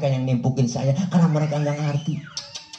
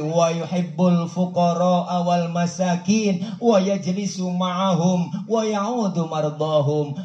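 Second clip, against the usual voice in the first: Arabic recitation; 4 semitones higher, slower than usual. Acted.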